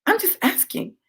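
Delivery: quiet creepy calm, spaced by pauses; calm crazy. Retold or imitated. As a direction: play innocent with eerie softness. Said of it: "calm, crazy voice".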